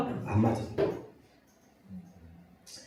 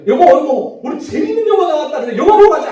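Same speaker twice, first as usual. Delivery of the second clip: much higher and louder, eager pleading; excited playful spouse; 9 semitones higher, 7 dB louder than usual. Retold or imitated. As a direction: gush like a playful spouse begging to go out. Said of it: "부부 사이에서 이런 거죠". (신나서 조르는 배우자 흉내)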